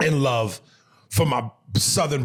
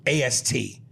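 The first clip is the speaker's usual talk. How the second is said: usual speaking voice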